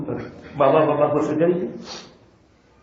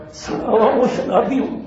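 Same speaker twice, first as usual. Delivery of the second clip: laughing; 3 semitones higher than usual. Real, not acted. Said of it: "laughing voicing drunkard". (ہنستے ہوئے شرابی کی زبان میں)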